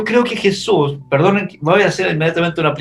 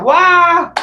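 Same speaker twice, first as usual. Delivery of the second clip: "¡Guau!" yelped much higher and louder; mock terrified yell; 12 semitones higher, 4 dB louder than usual. Preacher acting the disciples screaming at the ghost, mock terrified yell. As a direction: act out the disciples' fright with a squeal. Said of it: "gritaron de terror".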